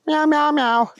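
high, running string of meows; meowing kitty. Acted. Thunder Kitty answering the police chief, meowing. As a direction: chatter away in cat meows. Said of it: "said Thunder Kitty".

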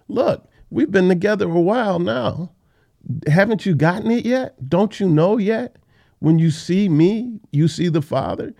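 high, insistent exasperated pleading. Acted. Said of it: "he's exasperated in his tone".

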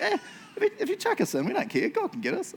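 carefree, laughing shrug; totally not worried. Retold or imitated. Friends tell the king to chuck them in, laughing and carefree. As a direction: toss it off, nothing to fear. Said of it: "They're like".

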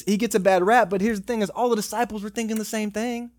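usual speaking voice

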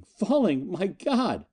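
high-pitched, aghast exclamation; panicked steward's outburst; horrified cry. A narrator's reading. Steward hears of the fall.

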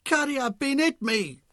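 very high, slow squeal. A narrator's reading. Arnold squealing as he crumples to the ground, very high. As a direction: squeal dramatically. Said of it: "Arnold squealed".

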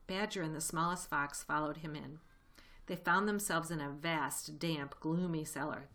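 usual speaking voice

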